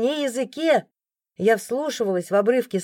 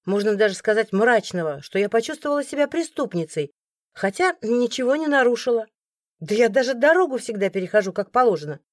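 plain reading voice

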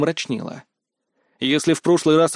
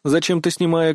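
ordinary delivery

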